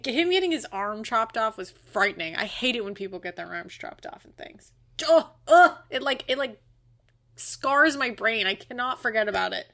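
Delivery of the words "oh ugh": louder, higher groan of disgust; real disgust. Real, not acted. Recalling the arm chopping scene to co-host, loud disgusted groan. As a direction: recoil with a loud ugh.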